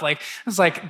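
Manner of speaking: normal talking voice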